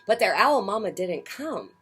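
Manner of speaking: ordinary delivery, nothing marked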